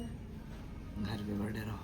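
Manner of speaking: low, slow mumble; mumbled reply. Real, not acted. Brother mumbles to sister about staying home. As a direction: drop it low and drag it out.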